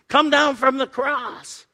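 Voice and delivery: high, slow, drawn-out jeer; taunting. Retold or imitated. Mockers calling to Jesus on the cross, taunting. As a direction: call it out as an imitated taunt. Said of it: "that taunted Jesus".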